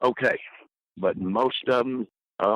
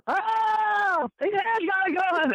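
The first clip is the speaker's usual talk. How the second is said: panicked woman; slower than usual. Retold or imitated. Woman panics again at deposition.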